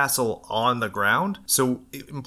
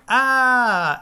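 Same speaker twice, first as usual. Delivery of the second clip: mock scream; 13 semitones higher, 7 dB louder than usual. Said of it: "And they're like".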